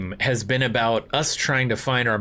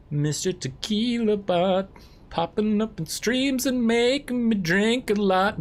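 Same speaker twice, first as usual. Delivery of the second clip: mock announcer; 8 semitones higher, slower than usual. Announcing a bot tagline.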